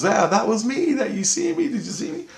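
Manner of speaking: very high, eager child imitation; retold high-pitched delight; excited little girl. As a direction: imitate an excited girl claiming her moment. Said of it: "she was all excited".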